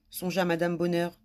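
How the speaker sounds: ordinary delivery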